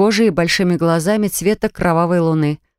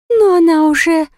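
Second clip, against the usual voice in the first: fairy queen voice; 9 semitones higher, 3 dB louder than usual. A narrator's reading. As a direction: taunt it with eerie slowness. Pixie queen taunts.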